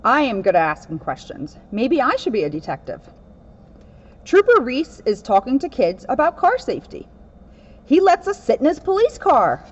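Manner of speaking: lively read-aloud storybook voice; picture book reading, lightly animated; reading to children. Acted. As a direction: read it to a room of kids.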